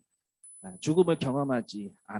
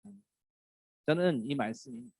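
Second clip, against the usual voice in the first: ordinary talk, nothing marked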